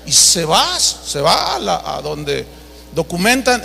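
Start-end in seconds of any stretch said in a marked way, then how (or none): none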